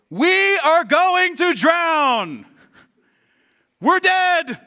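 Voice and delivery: loud, high wail, hugely drawn out; hammed-up doom, shouted high; panicked shouting. Disciples sure they will drown, panicked shouting.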